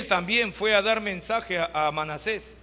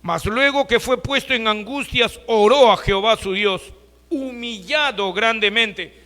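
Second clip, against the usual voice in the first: louder preaching, slowing on humillado grandemente; emphatic reading; 2 semitones higher, 7 dB louder than usual. Shown as a demonstration. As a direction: proclaim the verse to the congregation. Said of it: "Acá dice la palabra de Dios, mira".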